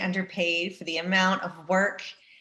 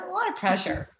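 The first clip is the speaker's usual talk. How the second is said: mock high echo; 12 semitones higher than usual. Athlete echoing her own line to the host, jokingly high. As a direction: repeat it in a much higher put-on voice.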